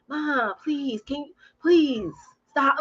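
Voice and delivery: mock a mortified child's plea; embarrassed kid. Kid to mom watching the school drop-off, embarrassed. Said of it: "she's just embarrassed".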